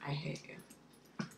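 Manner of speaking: slow whispered fake grudge; mock sulky whisper. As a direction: pretend to sulk in a whisper. Mock sulk at viewer.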